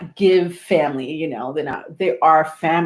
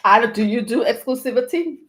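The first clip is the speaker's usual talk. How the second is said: usual speaking voice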